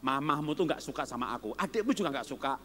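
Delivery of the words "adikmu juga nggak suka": normal talking voice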